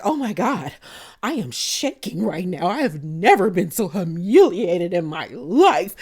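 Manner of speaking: mock-fragile pearl-clutching voice. [name] on the Sprinter, mocking a shaken humiliated woman. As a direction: play the humiliated rich lady, trembling.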